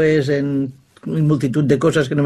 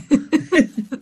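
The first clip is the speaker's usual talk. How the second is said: short high laugh; real amused laugh; 11 semitones higher than usual. Real, not acted. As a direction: laugh as the punchline lands. Laughs at the tale's punchline.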